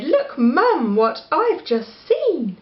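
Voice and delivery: put-on high eager child's voice; high excited kid; excited little girl. A narrator's reading. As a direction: tug at mum and blurt it out. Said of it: "She tugged her mother's sleeve and said".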